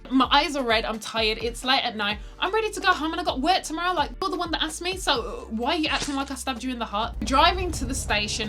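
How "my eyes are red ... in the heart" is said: louder, higher, indignant rant; exasperated rant. Retold or imitated. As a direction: vent at him, fed up. Ranting at the date.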